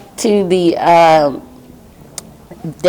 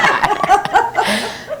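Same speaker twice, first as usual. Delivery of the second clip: bright laugh, much higher; real high laugh. Real, not acted. Woman remembering the nuns around her, real laughter. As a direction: laugh out at the memory.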